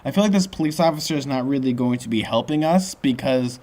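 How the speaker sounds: ordinary delivery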